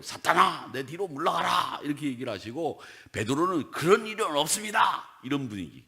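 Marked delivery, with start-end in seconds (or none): 0.0-1.8 higher, louder commanding rebuke; stern rebuke. Retold or imitated. Jesus rebuking Peter, a higher, louder command. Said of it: "막 예수님도 화를 낸 거예요". 3.7-5.0 higher, louder indignant denial; heated protest. Retold or imitated. Peter protesting to Jesus, higher, louder and indignant. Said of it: "막 화를 내고".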